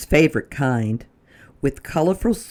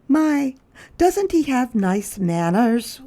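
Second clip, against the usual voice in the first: much higher, admiring lady's voice; 11 semitones higher than usual. Acted. A lady on the street praising Lyle's manners. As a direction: coo it in admiration. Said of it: "one lady remarked".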